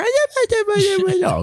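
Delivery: squealed high oh; sudden high-pitched oh at a dog; delighted gasp at a dog. Pretend. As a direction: break character with a squeal of joy. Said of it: "and then you see a dog".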